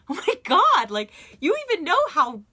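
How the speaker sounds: high and loud, shrieking in disbelief; yelling at the ad guy in disbelief; excited outburst. Retold or imitated. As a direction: burst out with mock outrage. Mocking his gross ads.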